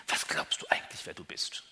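du weighted, whole question whispered; stress on du. Shown as a demonstration. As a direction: whisper it, leaning on du. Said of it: "wenn ich das so betone".

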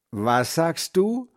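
lightly voiced grandfather. Deaf grandfather asking the boy to repeat. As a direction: lightly play a hard-of-hearing grandpa.

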